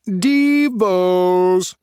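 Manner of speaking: high, loud call across the room; hollering a name. Acted. Young man hollering to friends across the bar. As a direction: holler his name from afar. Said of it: "a young man hollering".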